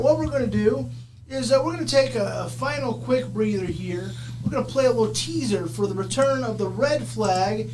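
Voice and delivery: normal talking voice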